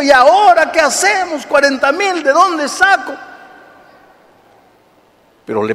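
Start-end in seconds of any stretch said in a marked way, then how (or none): 0.0-3.1 mock panic over the debt; desperate husband. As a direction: wail it in despair.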